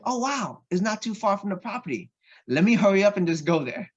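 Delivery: rushing thoughts of a find; retelling his eager plan; excited thinking aloud. Retold or imitated. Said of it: "excited discovery".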